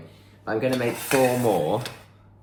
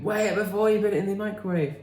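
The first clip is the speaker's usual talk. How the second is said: higher, fast mock nagging chorus; mock viewers nagging; 10 semitones higher, faster than usual. Viewers shouting at the screen about the butter, mock nagging. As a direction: parrot the viewers scolding her. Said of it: "Lots of you were shouting at the screen saying".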